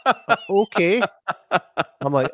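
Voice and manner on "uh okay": laughing. Real, not acted. Reacting to the creepy message.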